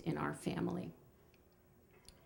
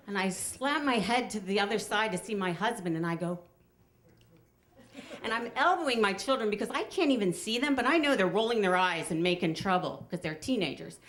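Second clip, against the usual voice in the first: ordinary talk, nothing marked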